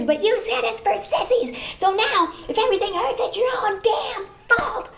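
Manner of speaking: high, shrill-pitched exasperated rant, laughing; losing it. Pretend. Grandchild finally snaps at complaining grandpa, high exasperated rant. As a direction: blow up at grandpa at last, half laughing. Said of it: "And then you lose it".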